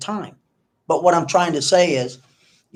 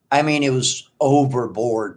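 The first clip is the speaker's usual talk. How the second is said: ordinary talk, nothing marked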